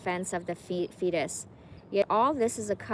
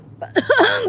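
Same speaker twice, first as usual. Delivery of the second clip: 1 crying cough; 14 dB louder than usual. Real, not acted. Breaking down mid reading.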